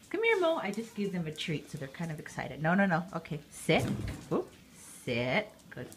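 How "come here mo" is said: higher, louder dog-calling voice; coaxing call to a pet. Real, not acted. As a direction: sing out her name to call her over.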